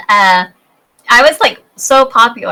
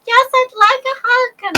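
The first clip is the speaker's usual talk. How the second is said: much higher, sweet put-on little girl. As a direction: squeak it like a shy little girl.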